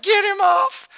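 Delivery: high, loud imitation of a boy shrieking for help; retold panicked shout. Pastor imitating his son's last cry for help, high panicked shout. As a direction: scream it like the steer is crushing your foot. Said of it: "He's like".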